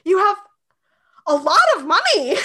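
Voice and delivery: high and loud, stretched in disbelief; loud high astonished imitation; stunned Alexis. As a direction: gasp it out like you just saw a fortune. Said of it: "And Alexis is like".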